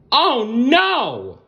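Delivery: high, startled cry of many little bugs; crowd gasping. Acted. The crowd of bugs gasping as the web breaks. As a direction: gasp it out as a shocked crowd. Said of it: "the crowd gasped".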